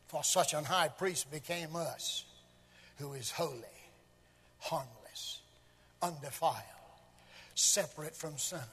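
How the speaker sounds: low, soft, with pauses between phrases; reverent recitation. Real, not acted. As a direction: lay each attribute down quietly. Hushed reverent scripture reading.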